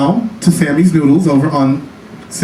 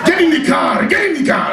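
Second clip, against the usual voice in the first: high, hammed-up barked order, laughing; mock yell; 10 semitones higher than usual. Imagined boyfriend yelling from the car, mock shout. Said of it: "be like, yell at me".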